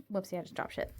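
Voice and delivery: under-the-breath whisper; whispered aside. Real, not acted. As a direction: mutter it to yourself, unvoiced. Mutters after dropping something.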